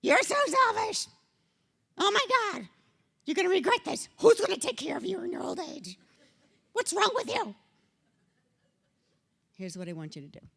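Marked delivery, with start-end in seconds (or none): 0.0-7.5 high, loud, hammed-up outrage; arguing critic. Critic attacking someone childfree in an argument, mock outraged. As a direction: pitch it way up like a scandalized accuser. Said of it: "You get into an argument".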